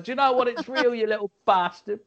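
high-pitched scolding rant; irate mum. Acted. Mum scolding her son as she injects him, high-pitched and irate. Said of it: "She's injected covid into his arm and going".

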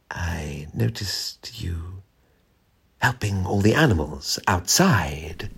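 very slow, soft, whispery purr; lazy cat. A narrator's reading. Cat addressing Jack from the radiator, lazy. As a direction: draw the words out languidly. Lazy cat's voice heard suddenly.

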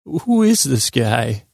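high, incredulous what the heck; stunned at your own old recording; baffled disbelief. Retold or imitated. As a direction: pitch up in mock horror at yourself. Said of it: "I'll be like".

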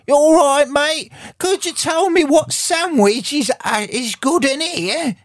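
loud, very high-pitched mock Australian; slow, hammed-up Australian squawk of a question; Australian accent. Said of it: "I could do an Australian".

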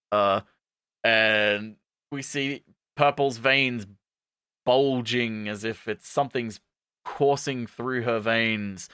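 ordinary talk

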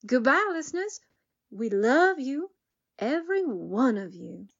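ordinary talk, nothing marked

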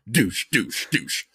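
low, evenly spaced thumping noise; vocal bass thumps; imitated club bass. Shown as a demonstration. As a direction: mimic a pounding speaker beat.

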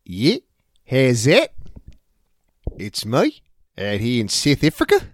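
a rough attempt at a South African accent; untrained South African accent. Shown as a demonstration. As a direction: try on a South African greeting. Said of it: "That's an accent I need to work on".

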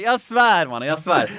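loud, high-pitched real insistence; insistent swearing. Real, not acted. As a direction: swear it's true over their disbelief. Insists to laughing disbelieving listener. (ivrigt bedyrande)

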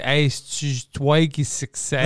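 put-on slow, teasing French accent; hammed-up French come-on; mock French seduction. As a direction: draw out the French line mockingly. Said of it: "You should have used the accent".

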